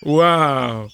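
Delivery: usual speaking voice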